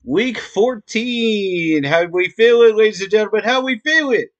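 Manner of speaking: loud, high hype host opener; hype intro. Acted. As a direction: pump up the crowd.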